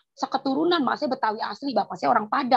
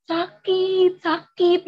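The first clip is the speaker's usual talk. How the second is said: higher-pitched mock wail of pain; meratap kesakitan; 7 semitones higher, much slower than usual. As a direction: imitate her own nightly cry of hurt. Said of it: "Jerit mulu". (menirukan rintihan kesakitan)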